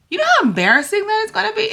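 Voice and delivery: high, fast, squeaky panic; panicky high voice. Real, not acted. Telling viewers about her fear, high and frantic. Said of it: "the little girl inside of me is freaking out".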